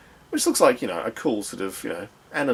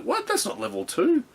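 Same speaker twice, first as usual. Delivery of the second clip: surprised. Retold or imitated.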